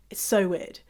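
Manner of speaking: ordinary talk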